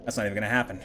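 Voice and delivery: quick aside. Real, not acted. Quick aside about stream time.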